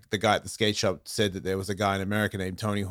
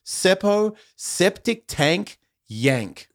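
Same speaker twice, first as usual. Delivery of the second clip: slow, spaced-out explaining, starting high; slow breakdown; 7 semitones higher, much slower than usual. Shown as a demonstration. Australian explains rhyming slang to a slow listener, slow and deliberate. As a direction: lay it out slowly, piece by piece.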